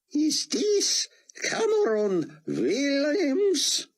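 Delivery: put-on a frail old rabbi straining through a thick Hebrew accent. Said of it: "came the thick Hebrew accent".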